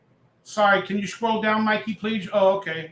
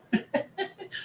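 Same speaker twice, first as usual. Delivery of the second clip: airy laugh; 10 semitones higher, 9 dB quieter than usual. Real, not acted. Laughs at own bit.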